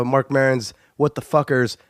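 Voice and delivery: ordinary talk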